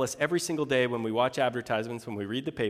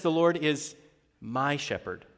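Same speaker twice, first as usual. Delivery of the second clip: "my" leaned on as the personal word; stress on my. Shown as a demonstration. Preacher stressing "my" to make it personal. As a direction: land on "my" in "my shepherd". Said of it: "makes it really personal".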